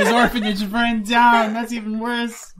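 high, loud, drawn-out laughing cry; real laughing. Real, not acted. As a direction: laugh helplessly through the words. Laughing at a grim backstory.

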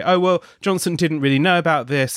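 mock excuse. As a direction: lightly imitate an official brushing it off. Voicing Number Ten's excuse on air.

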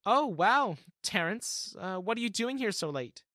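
startled to see him so late; surprised. Acted. Diana surprised by Terrence at the elevator, high and startled. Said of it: "she asked".

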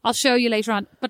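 ordinary delivery

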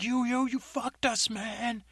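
high accusing voice; angry fired worker. Acted. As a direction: get in his face, high. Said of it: "He's like".

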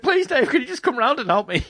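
high, whiny begging for help; mock crying plea. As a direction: plead like a hammed-up crybaby. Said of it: "rolling around crying, just going like".

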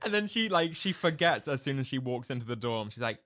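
normal talking voice

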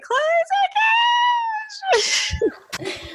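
squealed announcement held long; retold scream. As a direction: imitate screaming the big news, stretching the last word. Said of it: "and then I screamed".

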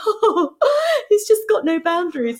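high, gushing mock delight; mocking laughing parent; imitated doting parents. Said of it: "they're, like, laughing".

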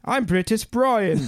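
shaky attempt at introducing yourself in a British accent. Shown as a demonstration. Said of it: "You're in England".

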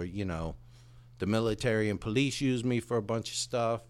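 a hint of a proud old soldier dog; talking dog. Talking dog tells its story.

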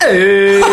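loud, high held 'oo' with the M dropped; moo without the M. Shown as a demonstration. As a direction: belt out a moo minus its first consonant. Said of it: "Sometimes I even drop the M".

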